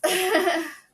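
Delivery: high, loud laugh; burst of laughter. Real, not acted. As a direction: laugh out loud at your own verdict. Laughs after mocking the book.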